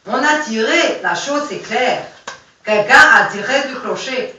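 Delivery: put-on a half-mastered German accent. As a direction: bark the accusation like a German captain, a hint of accent. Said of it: "l'accent allemand, je maîtrise pas très bien".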